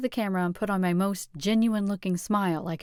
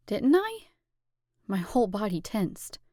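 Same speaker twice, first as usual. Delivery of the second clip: plain reading voice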